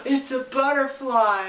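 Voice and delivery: hammed-up gasp of delight; mock wonder. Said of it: "and she's like".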